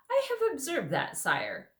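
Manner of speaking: high, crisp reply from a dignified mouse; dry proud mouse. Acted. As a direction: answer with stiff courtly dignity. Reepicheep the mouse speaks.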